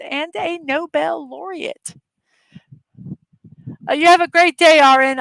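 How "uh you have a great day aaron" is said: calling out. Real, not acted. Calling goodbye to a viewer.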